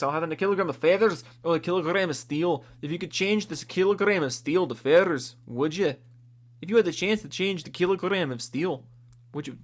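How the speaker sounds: badly faked Scottish accent. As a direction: put on a wobbly Scottish accent. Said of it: "used to be able to do a better accent".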